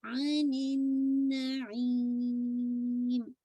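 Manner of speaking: अनिन नईम with a very long ई, म at the close; nasal at end. Shown as a demonstration. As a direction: hold the ee of नईम steady before humming. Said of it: "nasal sound at". (अनिन नईम बहुत खींचकर, गुन्ना आख़िर में)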